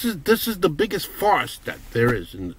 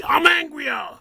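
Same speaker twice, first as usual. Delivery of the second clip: angry shout; slower than usual. Real, not acted. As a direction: yell it to top angry grandpa. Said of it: "You think angry grandpa's angry?".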